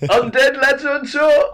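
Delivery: high, loud laughing repeat. Real, not acted. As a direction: chant it while cracking up.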